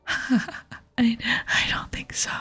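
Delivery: amused, whispered dismissal; whispered scoffing laugh. Acted. Willow laughs off the player's offer to lift rocks, whispered amusement. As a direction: laugh lightly through a whisper.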